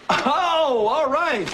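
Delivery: high delighted oh; light up at the sight of it. Acted.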